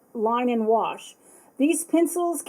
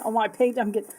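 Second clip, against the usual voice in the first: normal talking voice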